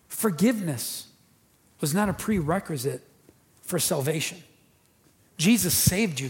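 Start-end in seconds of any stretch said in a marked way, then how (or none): none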